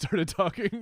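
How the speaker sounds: ordinary talk, nothing marked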